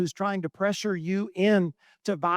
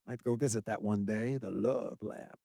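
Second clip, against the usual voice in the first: quiet wistful aside; 8 semitones lower, 8 dB quieter than usual. Real, not acted. Preacher musing to the congregation about visiting the love lab, soft and dreamy. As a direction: drop the voice as if musing to yourself.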